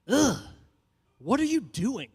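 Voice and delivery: scornful high ugh, what are you doing; mock disgusted woman; annoyed woman. Young woman scorns him for holding the door, mock disgusted.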